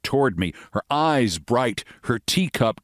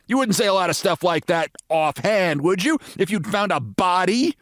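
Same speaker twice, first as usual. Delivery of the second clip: American lady voice; 9 semitones higher than usual. Acted.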